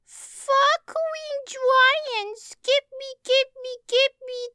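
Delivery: put-on high squeaky toddler stammering; stammering kid voice. A narrator's reading. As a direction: stumble over the words like a little kid. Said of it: "the boy stammered".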